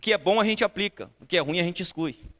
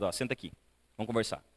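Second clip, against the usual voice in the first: put-on quiet and gentle, much lower and softer, like sitting beside a sad child; soft fatherly invitation; 8 semitones lower, 8 dB quieter than usual. A father gently calls his son to sit and talk after losing, soft and hushed. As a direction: ease him down to talk, hushed.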